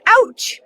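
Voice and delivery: high yelp of pain; sharp ouch. Acted. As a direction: yelp at the needle. Getting a shot.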